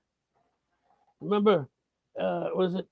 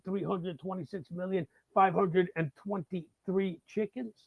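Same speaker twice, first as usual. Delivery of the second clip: normal talking voice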